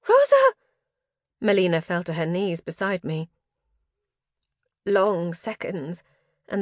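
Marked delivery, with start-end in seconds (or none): 0.1-0.5 loud, high-pitched shout of the name; alarmed cry. A narrator's reading. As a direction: cry out in panic to a friend. Melina cries out in alarm.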